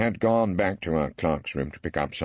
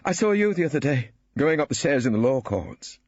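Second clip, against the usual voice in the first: a sudden eager outburst, pitched up; blurted out; 7 semitones higher than usual. A narrator's reading. Ballard confessing to Phillida, blurting it out. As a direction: rush it out before nerves fail. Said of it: "he blurted out".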